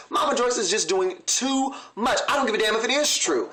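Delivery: usual speaking voice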